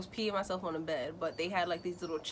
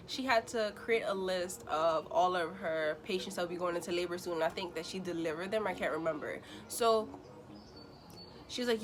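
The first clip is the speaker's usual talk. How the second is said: ordinary talk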